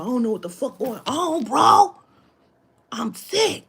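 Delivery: rising to a high, loud cry on "bro. I'm sick"; panicked outburst. Retold or imitated. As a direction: voice the friend losing it on the phone. Friend panicked on phone.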